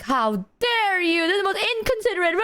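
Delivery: high, mock-outraged mother voice; mock outraged mom. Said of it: "And she was like".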